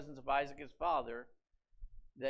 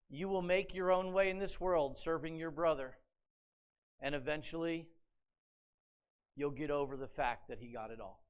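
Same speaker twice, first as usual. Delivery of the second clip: normal talking voice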